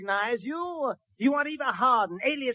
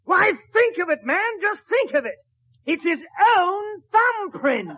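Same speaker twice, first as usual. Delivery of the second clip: much higher, eager explanation; excited detective; 8 semitones higher, 4 dB louder than usual. Acted. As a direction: push it up high with glee.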